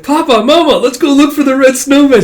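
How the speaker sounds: put-on high, loud, thrilled little kid; excited child. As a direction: squeal it eagerly to Mama and Papa. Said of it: "she's getting so excited".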